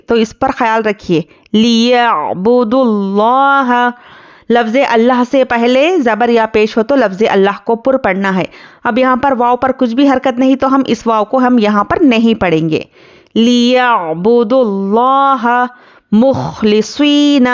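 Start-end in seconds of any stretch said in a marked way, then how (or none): 1.5-3.9 slow, melodic tajweed recitation; measured Quranic chant. Shown as a demonstration. Recites verse fragment. 13.3-17.5 slow, melodic tajweed recitation; measured Quranic chant. Shown as a demonstration. Recites verse fragment.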